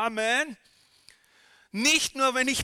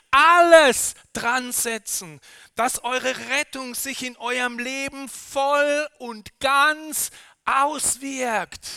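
emphatic shout; 4 semitones higher, 8 dB louder, slower than usual. Real, not acted. Preacher stressing the call.

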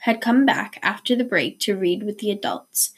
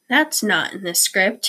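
ordinary delivery

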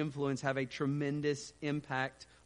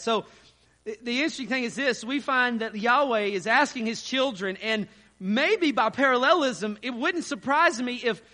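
rising sermon voice, higher and louder; preaching; 8 semitones higher, 8 dB louder than usual. Acted. Pastor driving the point home to congregation, preaching. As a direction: preach it with lifted force.

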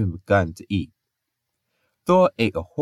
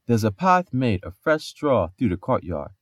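plain reading voice

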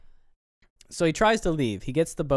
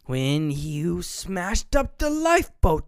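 slow, louder accusing voice; imitating Wake; 4 semitones higher, 4 dB louder, slower than usual. Friend retelling Wake's lie to Tommy, slow accusing voice. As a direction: spell out the accusation slowly. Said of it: "He's like".

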